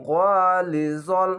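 drawn out syllables, each held; teacher reciting slowly for students; slow Quran recitation. Shown as a demonstration. Teacher models the word slowly for learners. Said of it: "এক আলিফ টেনে পড়বেন".

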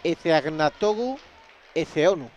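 Ezeagnatogu Ezeonu, slow and loud, part by part; careful surname. Shown as a demonstration. Commentator showing listeners a player's long surname, pronounced slowly and carefully. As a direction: sound out Ezeagnatogu, pause, then Ezeonu. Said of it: "y el apellido es".

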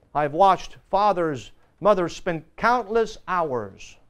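ordinary delivery

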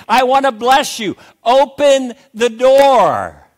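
Jesus knocking and calling to the church to let him in. Acted. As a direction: call out with longing, begging them to open. Preacher voices Jesus calling at door.

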